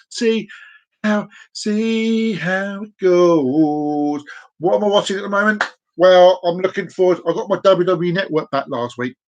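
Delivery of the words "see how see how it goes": very slow, stretched-out vowels; playfully drawn out. Pretend. Host repeats himself to viewers, playfully drawn out. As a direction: drag every word out long.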